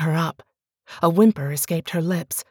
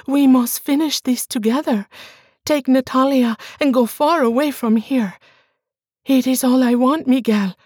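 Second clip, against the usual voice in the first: much higher and louder, begging; desperate pleading wife; 7 semitones higher, 4 dB louder than usual. Acted. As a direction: beg him for belief. Bianca urges.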